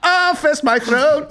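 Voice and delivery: high, slow mock rage; over-the-top aggressive. Shown as a demonstration. Showing his fake rage after dropping coffee, high and slow. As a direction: ham up the fury. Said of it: "just so over the top aggressive".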